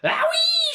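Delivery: very high, loud crone squeal; shrieking whoop. Acted. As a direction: squeal as the dose hits. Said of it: "she screamed".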